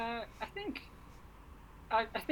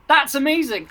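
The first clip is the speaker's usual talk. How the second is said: mock awed praise; 9 semitones higher, 5 dB louder than usual. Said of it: "And there's me just got".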